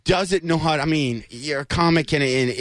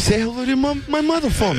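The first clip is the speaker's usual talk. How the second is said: much higher, pushy Boston-ish bark; Mark Wahlberg; 8 semitones higher, faster than usual. Retold or imitated. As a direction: say it like a street-tough actor. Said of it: "Mark Wahlberg".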